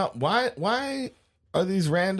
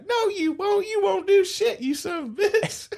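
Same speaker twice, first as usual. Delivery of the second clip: very high, hammed-up defiant holler. Dwayne yelling back at the angry husband, high mock defiance. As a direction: yell back in a squealing high voice. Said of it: "And the rednecks yell back".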